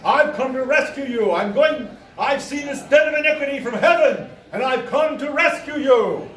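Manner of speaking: high-pitched zealous announcement through a door; self-proclaimed archangel. Retold or imitated. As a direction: declaim it like a deranged prophet. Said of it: "He's like".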